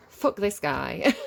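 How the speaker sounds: annoyed curse. Real, not acted. Angry aside at Thoth card.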